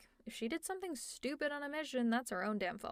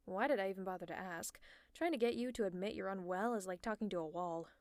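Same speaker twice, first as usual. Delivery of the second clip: ordinary talk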